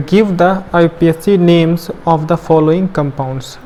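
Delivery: slow, loud, word-by-word reading of the question. Shown as a demonstration. Teacher reads the NCERT question slowly and loudly to students. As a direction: spell the question out slowly for the class. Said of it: "कह रहा है मुझसे".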